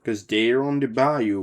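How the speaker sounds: ordinary delivery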